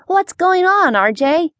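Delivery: bright-pitched cheerful question with a smile; smiling. A narrator's reading. Girl greeting her cousin on the phone, smiling. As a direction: ask it through a smile, pitched high. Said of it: "asked with a smile".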